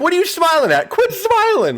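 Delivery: very high-pitched mock scolding; mock voiceover. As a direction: imitate a streamer telling off the screen. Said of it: "voice that over".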